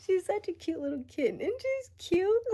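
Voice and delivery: high, loud sing-song cooing at a cute pet, slowing at the end; mock cooing. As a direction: coo at her like a little kitten, mocking the people who won't take her anger seriously. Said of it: "It's like".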